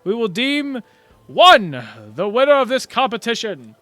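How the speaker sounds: loud, grand tournament announcer voice; booming announcer. Acted. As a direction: proclaim it to the whole crowd.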